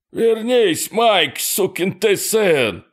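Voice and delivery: very high, slow wailing plea; pained moaning cry. A narrator's reading. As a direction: groan it out, badly wounded. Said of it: "простонал за его спиной Фрэнк".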